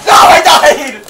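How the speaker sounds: high, loud cry of defeat; dismayed shout. Real, not acted. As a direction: yell out as you get killed. Killed in game.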